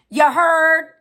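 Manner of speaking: high, loud challenge to chat; loud callout. Real, not acted. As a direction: call it out to the whole room. Calling out to chat.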